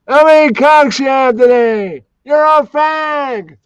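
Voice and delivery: very high, loud, shouted put-on voice; Matthew Weaver character. Demonstrating the character on camera, high shouted put-on voice. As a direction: yell it way up high, stretching the ending. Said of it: "He would ask you how many something".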